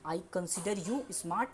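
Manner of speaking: slow short English example; slow model sentence. Shown as a demonstration. Teacher contrasting two example forms. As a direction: say it slowly. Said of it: "example देते हैं".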